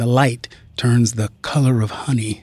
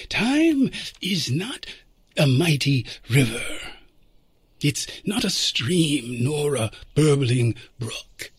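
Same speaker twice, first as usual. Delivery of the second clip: noir detective; 4 semitones higher, 5 dB quieter than usual. Acted. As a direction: draw out each idea like a secret. Time traveler explains time travel.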